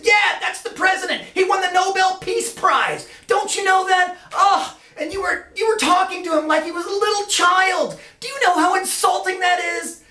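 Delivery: very high, frantic telling-off; aghast scolding. Retold or imitated. Boss scolding Lance for insulting the president, high frantic outrage. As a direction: rant in high-pitched horror. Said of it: "He said".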